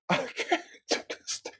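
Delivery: breathless, wheezing through laughter; barely getting words out for laughing; laughing too hard. Real, not acted. Cracking up at own joke.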